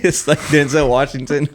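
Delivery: laughing through the words. Real, not acted. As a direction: giggle as you say it. Laughing over a meme.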